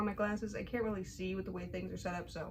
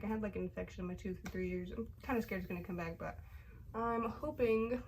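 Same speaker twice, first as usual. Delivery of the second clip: ordinary talk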